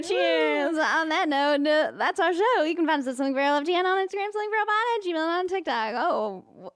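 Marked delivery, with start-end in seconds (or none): 2.6-5.9 fast rattled-off plug; auctioneer. Real, not acted. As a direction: rush it like an auctioneer. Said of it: "I sound like one of those".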